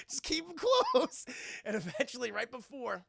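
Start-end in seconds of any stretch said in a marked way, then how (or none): 0.1-1.3 high, loud and stretched out; acted insistent shout; exasperated call. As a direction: holler it slowly. Crew answers Justin, acted.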